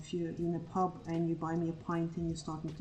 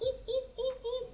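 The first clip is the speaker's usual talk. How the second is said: high squeaky yips like a tiny dog; yappy little dog imitation; faster than usual. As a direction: yip high like a Chihuahua. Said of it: "yappy type dog".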